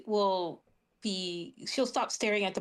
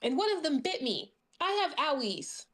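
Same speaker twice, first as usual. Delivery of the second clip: put-on higher, small childlike complaint; childish whine; 6 semitones higher than usual. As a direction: pout about the bite like a little kid.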